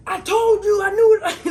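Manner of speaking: high, shouted I-told-you-so; imitating father going off. Father told he was right, exultant shouting. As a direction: yell it triumphantly as dad. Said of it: "Like, went off".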